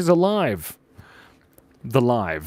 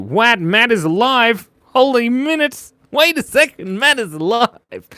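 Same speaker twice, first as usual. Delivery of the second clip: high, loud astonished outburst; hammed-up amazement; 9 semitones higher, 5 dB louder than usual. Streamer reacting to a viewer suddenly turning up, hammed-up amazement. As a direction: gasp it out, high and stretched.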